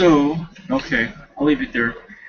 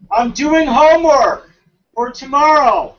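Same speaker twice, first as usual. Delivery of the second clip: loud, higher, slow and drawn out; annoyed shout; 6 semitones higher, 8 dB louder than usual. Real, not acted. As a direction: yell through the wall, irritated. Yelling back to mom offscreen.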